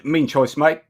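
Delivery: put-on matey Kiwi accent; New Zealand accent. Guest thanking his Kiwi friends for support, New Zealand accent. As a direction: lay on your best New Zealand accent. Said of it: "putting on my best New Zealand accent".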